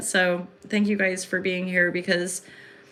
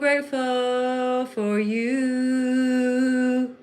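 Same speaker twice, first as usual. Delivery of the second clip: tender thanks; 5 semitones higher, much slower than usual. Real, not acted. Thanking her live viewers, she draws it out very slowly and tenderly. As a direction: linger on each word lovingly.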